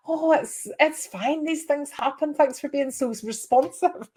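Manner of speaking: high-pitched, gracious mock customer reply; forgiving customer.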